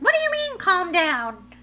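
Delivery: loud, high-pitched mock outrage; acted-out yell of taking it personally; indignant outburst. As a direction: snap back as if deeply offended. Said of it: "get in my feels and be like".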